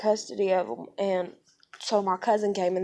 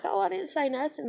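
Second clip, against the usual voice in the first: innocent denial; 7 semitones higher than usual. Retold or imitated. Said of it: "And she's like".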